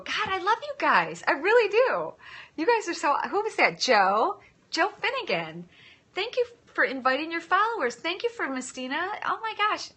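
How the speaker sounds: high, loud, overflowing thanks to viewers; emotional gush. Real, not acted. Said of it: "I'm, like, so emotional".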